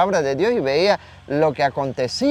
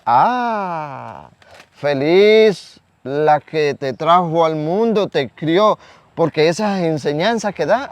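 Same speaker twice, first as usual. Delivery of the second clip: acted outburst of praise, words drawn out; emotional cry; 2 semitones higher, 5 dB louder, slower than usual. A woman in the crowd crying out to Jesus, emotional. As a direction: call it out slow and loud. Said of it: "pongan que esta mujer gritaba".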